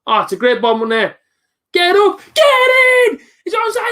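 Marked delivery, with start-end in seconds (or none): 1.7-3.2 high, loud goal shout; excited yell. Real, not acted. Live goal reaction on stream. 3.4-3.9 high, quick excited cry; excited shout. Real, not acted. Celebrating a goal on stream.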